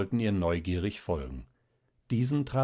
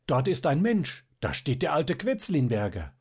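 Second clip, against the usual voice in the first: crowd shouting; 6 semitones higher than usual. A narrator's reading. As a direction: call it out in alarm. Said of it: "schrien plötzlich einzelne Stimmen". (lauter, hoher Ruf aus der Menge)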